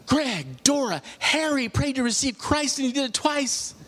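high, breathless-with-news quote; excited retold news. Woman tells Greg that his father received Christ, excited. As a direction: imitate someone bursting with big news, pitched high.

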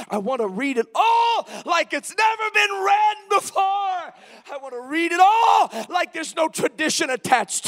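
high, loud shouted refrain; full-force preaching. Real, not acted. Preacher crying out to read it raw, full-force shouted preaching.

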